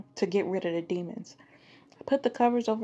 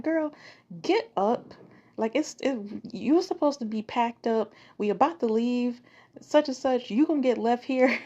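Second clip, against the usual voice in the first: grandma hurrying; 4 semitones higher than usual. Retold or imitated. Grandma tells girl to pack up, high and sharp. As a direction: rush the kid along like grandma. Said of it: "She like".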